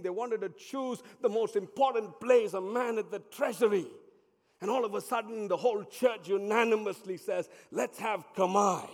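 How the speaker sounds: loud, high, urgent sermon storytelling; full-force preaching. Acted.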